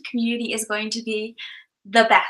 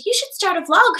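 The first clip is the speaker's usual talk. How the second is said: retold eager urging; 9 semitones higher than usual. Quoting herself urging her brother.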